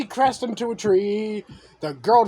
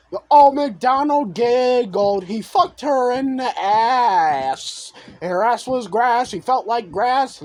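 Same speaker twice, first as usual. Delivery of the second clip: usual speaking voice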